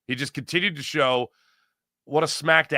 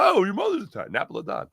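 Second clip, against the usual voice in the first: lightly voiced older Italian, rising excited oh; a hint of an delighted older Italian; 6 semitones higher, faster than usual. Host plays an older Italian meeting a chatter, lightly voiced. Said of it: "I'm gonna be the older Italian here".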